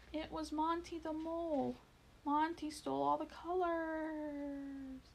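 high call from the sky, slowing at the end; crying out. A narrator's reading. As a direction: cry out the news to the mice below. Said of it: "Quickly he cried out".

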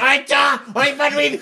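high-pitched noisy bird voice; mock bird chatter. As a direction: squawk it like birds outside the window. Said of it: "little fucking birds under my fucking windows. They're going".

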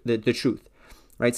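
ordinary talk, nothing marked

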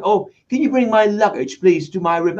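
mock British accent. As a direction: switch to a posh British accent to be understood. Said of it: "te cambias el acento".

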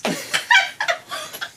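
squeaky high laugh. Real, not acted. Laughs at own joke.

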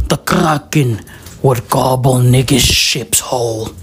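low, brooding legend-teller voice; low narration with long, suspenseful pauses; ominous storytelling. Acted. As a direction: tell a sea-monster legend in a deep register. Tells the Kraken legend ominously.

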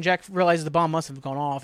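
ordinary talk, nothing marked

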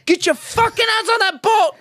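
mock Scottish shout. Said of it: "It's like".

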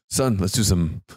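low, mock-fatherly invitation; playful dad voice. Host acts a father to his son, low dad voice. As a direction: drop low like a dad proposing a bonding session. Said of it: "I'm gonna teach my son".

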